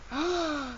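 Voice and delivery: high, soft intake of breath; shocked gasp. Real, not acted. Reader reacts to the culprit's name, gasping softly. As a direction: gasp at a plot twist.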